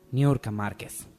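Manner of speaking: normal talking voice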